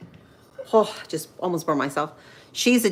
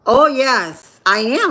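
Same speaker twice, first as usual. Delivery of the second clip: ordinary delivery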